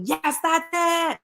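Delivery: high, drawn-out cheer to a student; teacher calling out encouragement; excited approval. Acted.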